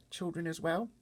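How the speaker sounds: put-on little girl Sophie. Sophie asking the giant about the other giants, small high girl voice. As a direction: speak up like a worried little girl.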